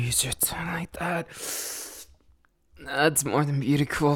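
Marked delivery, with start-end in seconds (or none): 0.0-1.3 softer, unvoiced whisper. Lover reacting to partner's touch, whispered. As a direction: let it slip out as a breath.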